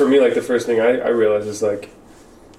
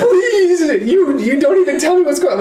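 high, pleading worker voice, much higher; whiny worker; 4 dB louder than usual. Retold or imitated. Worker begging the foreman for an explanation, high pleading whine. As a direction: beg like a confused new hire. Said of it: "And they're like".

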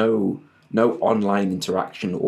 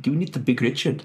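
put-on quick South African accent, pitched up; 7 semitones higher, faster than usual. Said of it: "when said in a South African accent".

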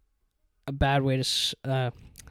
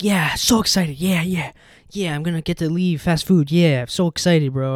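excited; 2 semitones higher, 5 dB louder than usual. Retold or imitated. Retelling own excitement over interview.

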